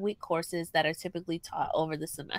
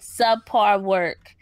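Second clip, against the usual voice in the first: slow, louder subpar work; pointed emphasis; 3 semitones higher, 7 dB louder, much slower than usual. Real, not acted. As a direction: land the jab at the student. Complaining about the student.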